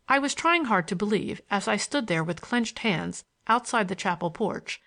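ordinary delivery

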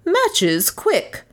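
high, sharp order; commanding. A narrator's reading. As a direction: snap out the order for matches. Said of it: "commanded Miss Cornelia".

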